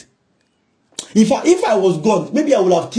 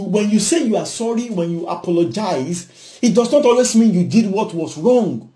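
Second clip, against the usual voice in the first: ordinary talk, nothing marked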